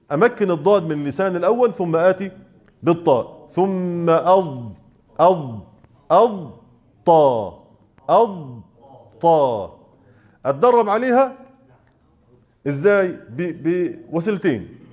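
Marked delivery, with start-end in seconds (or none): 3.5-9.8 أض then طا split with a pause; teacher drilling separated syllables; slow drill. Shown as a demonstration. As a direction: hold أض, break, then طا, very slowly. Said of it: "أسكت سكتة لطيفة".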